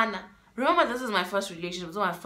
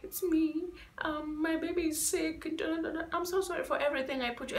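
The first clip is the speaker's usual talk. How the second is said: higher, softer pleading voice of the ex; mimicking the ex; 7 semitones higher, 6 dB quieter than usual. Mocking the ex begging on the phone, higher and softer. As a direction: play her as small and apologetic. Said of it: "She's like".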